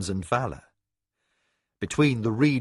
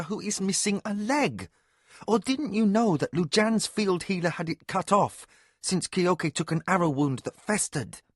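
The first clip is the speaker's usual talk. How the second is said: Mara voice; 8 semitones higher than usual. A narrator's reading. Mara argues with Kevin.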